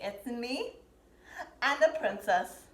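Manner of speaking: ordinary talk, nothing marked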